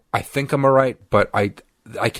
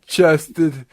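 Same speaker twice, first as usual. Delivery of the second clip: high, squeezed explanation; pinched, pitched-up voice; weird strained voice; 4 semitones higher than usual. Retold or imitated. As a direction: talk as if recovering from a nasal swab. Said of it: "I still have that weird voice".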